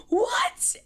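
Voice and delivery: a very high squealed "What?"; shocked shriek. Retold or imitated. Coach hears her student won state and shrieks in disbelief.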